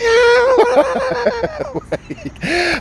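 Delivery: long, high-pitched laughing fit. Real, not acted. Vlogger cracking up at own rant, high laughing.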